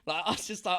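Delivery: ordinary delivery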